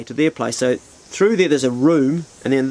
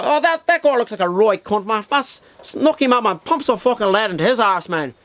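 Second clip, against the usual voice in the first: another high-pitched Dublin shooter; hammed-up Dublin accent, goading a mate; 7 semitones higher than usual. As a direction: mimic a worked-up Dublin lad, high and lilting. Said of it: "And then another guy".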